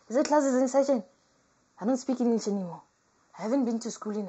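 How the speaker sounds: loud, fast flustered rant; flustered outburst. Pretend. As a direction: blurt it out in a panic. Flustered loud self-mockery.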